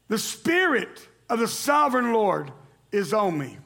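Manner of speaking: scripture reading. Acted. Pastor reading Isaiah aloud to church, ringing. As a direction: proclaim the verses to the congregation. Reads verse with congregation.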